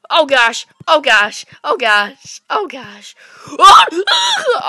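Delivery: loud high frantic cries; wailing distress; panicked cries. Real, not acted. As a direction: cry out in panic. Game panic.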